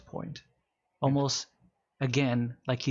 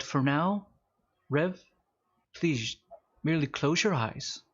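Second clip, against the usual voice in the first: guided meditation. Acted. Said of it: "and he goes".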